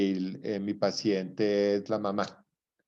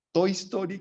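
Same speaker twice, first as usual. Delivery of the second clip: "Toy Story" mucho más agudo, exclamado con entusiasmo; entusiasmado; 9 semitones higher than usual. Real, not acted. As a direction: anuncia "Toy Story" como un hallazgo alegre. Nombra la película con entusiasmo. ("Toy Story" dicho alto y emocionado)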